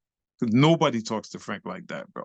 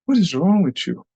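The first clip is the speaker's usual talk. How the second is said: louder, higher baffled demand; retold exasperation; 6 semitones higher, 6 dB louder than usual. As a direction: demand it in disbelief, acted. Baffled teacher quoted.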